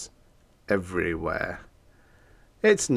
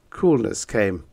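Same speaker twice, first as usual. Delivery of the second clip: usual speaking voice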